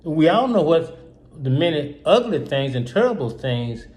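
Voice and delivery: ordinary talk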